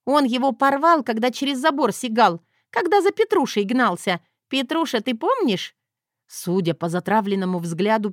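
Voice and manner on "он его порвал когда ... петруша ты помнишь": put-on high, loud gleeful girl; little girl voice. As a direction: blurt it out like a kid.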